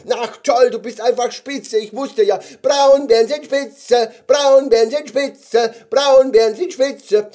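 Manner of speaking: high, loud, excited squeaky chant; overjoyed cheering. Acted. Gummy bear celebrates its new friend, overjoyed high shouting. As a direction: cheer wildly like a delighted little creature.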